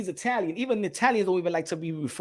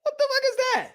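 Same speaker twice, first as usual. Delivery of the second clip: jumps way up high in mock disbelief; high-pitched mock outrage; incredulous. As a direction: squeak it out like you can't believe it. Mocking a crayon label.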